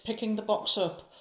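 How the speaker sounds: usual speaking voice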